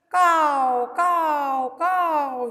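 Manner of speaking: high, loud cawing; crow caw imitation. Shown as a demonstration. Teacher caws like a crow for the class, loud and high. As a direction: caw loudly like a crow on a roof. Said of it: "He's doing, no?".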